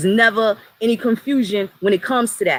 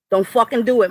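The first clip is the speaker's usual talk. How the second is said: ordinary talk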